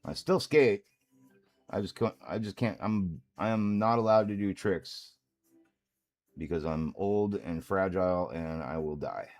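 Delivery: ordinary delivery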